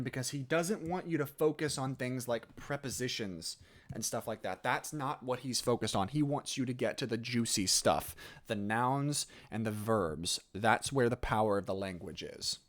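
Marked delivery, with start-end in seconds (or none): none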